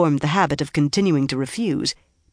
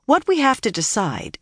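higher, matter-of-fact restart; as if nothing happened; 6 semitones higher than usual. A narrator's reading. Egwene resumes after the quarrel, as if nothing happened. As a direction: move on with understated unconcern. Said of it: "as if nothing at all had happened".

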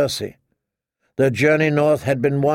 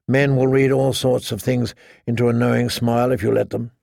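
plain reading voice

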